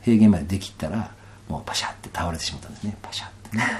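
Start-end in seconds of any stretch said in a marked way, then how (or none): 1.5-3.6 soft whisper, quick. Retold or imitated. Retelling collapsing on the plain, whispered. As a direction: breathe it out like collapsing.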